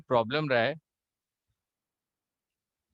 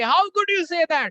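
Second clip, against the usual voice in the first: louder, higher, slow mock yell; hammed-up louder retort; mock shout; 12 semitones higher, 5 dB louder, much slower than usual. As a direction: shout it back harder. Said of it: "आप भी बोलोगे".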